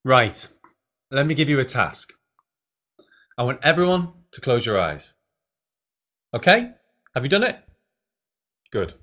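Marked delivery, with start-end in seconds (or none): none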